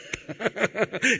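a high bright burst of laughter; preacher laughing at his own mirror punchline; real laugh. Real, not acted. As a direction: laugh at the joke on us.

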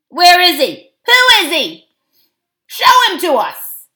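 high, loud heckling shouts; jeering dwarfs shouting; clamouring demand. A narrator's reading. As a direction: fire demands like an angry crowd.

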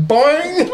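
high springy boing; cartoon boing; boing sound effect. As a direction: bounce the word like a cartoon spring.

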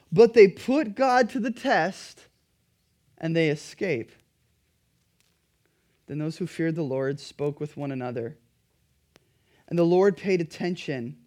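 ordinary delivery

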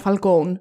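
Falcone said "fal-KOHN", no final vowel; Falcone as in English. Retold or imitated. Host quoting the film's odd Falcone, "fal-kown". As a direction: end Falcone on a long "-kown". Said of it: "μου ξένησε πάρα πολύ όταν είπανε".

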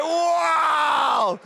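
loud, hugely stretched-out wow; high, booming mock astonishment; amazed wow. Amazed at the changed story.

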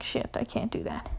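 soft unvoiced mutter; muttered swear. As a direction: mutter it to yourself, exhausted. Inner thought, tired.

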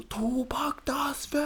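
very high, long-drawn mock order; hammed-up high scolding; angry squeaky teacher. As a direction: stretch every word in a thin, squeaky teacher voice.

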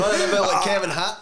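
high-pitched, laughing as he speaks. Real, not acted. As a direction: say it while still giggling. Mates laughing at a drug story.

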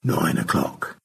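unvoiced, only mouthing the words; mouthed. Stanley secretly mouths the time to Margaret, unvoiced. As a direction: mouth it silently. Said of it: "He mouthed".

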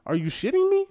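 very high incredulous squeal. Real, not acted. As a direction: shriek your disbelief. Outraged at huge spiders.

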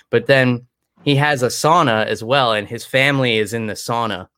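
normal talking voice

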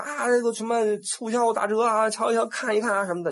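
high, sing-song shop barker's cry; a touch of a market hawker drumming up customers; street hawker calling out. Retold or imitated. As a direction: call out to passers-by like a shop tout. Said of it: "跟那儿嚷嚷说".

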